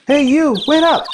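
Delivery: yelling after them. Acted. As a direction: shout to stop them walking off. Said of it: "You think he's yelling at us?".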